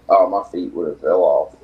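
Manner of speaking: usual speaking voice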